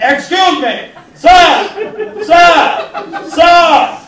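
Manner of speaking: loud, high-pitched heckler's holler; Edwardian heckler. Retold or imitated. Comic heckling the wrestler walking to the ring, loud Edwardian heckler. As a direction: call out sharply as an indignant period gentleman. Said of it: "I did the Edwardian heckler at the wrestling".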